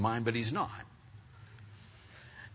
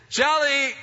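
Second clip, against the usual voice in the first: loud, high shout down the line; yelled call; 11 semitones higher, 5 dB louder than usual. Retold or imitated. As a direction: call out urgently for a refill. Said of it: "I yelled, uh".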